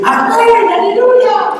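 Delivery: mock cheer of a delighted crowd; joyful cheer. Imagined church crowd cheering the sermon, high and jubilant.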